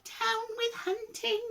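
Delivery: very high, slow, wavering chant; high hoot. A narrator's reading. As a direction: hoot it slowly like a gentle dinosaur. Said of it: "For he hooted".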